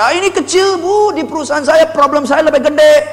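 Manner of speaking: very high, breezy one-upping; cheerful dismissal. Shown as a demonstration. Salesperson belittling a customer's stress, over-cheerful. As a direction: toss her worry aside at a high pitch. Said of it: "Tidak boleh pakai nada yang ceria".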